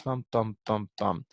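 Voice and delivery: four even, separate "Bum" beats; counting beats. Shown as a demonstration. Teacher marks four syllables for the class, beat by beat. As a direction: tap out "Bum, bum, bum, bum" evenly. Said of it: "I heard four syllables".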